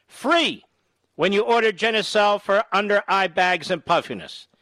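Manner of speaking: energetic infomercial pitch. Acted. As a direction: sell it like a TV ad. Host reads sponsor ad.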